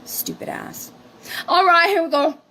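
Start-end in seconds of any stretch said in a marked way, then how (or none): 0.0-0.9 whispered put-down; whispered aside. Real, not acted. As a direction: whisper it under your breath. Muttered aside.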